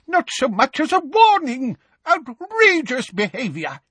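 high-pitched spluttering indignation; outraged shout. A narrator's reading. Professor protesting the ambush, outraged shout. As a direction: cry out in scandalized protest. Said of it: "shouted Professor Tofty".

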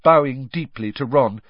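ordinary delivery, nothing marked

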